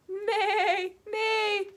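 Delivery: high whinnying neigh; playful horse whinny. Pretend.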